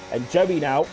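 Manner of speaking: ordinary talk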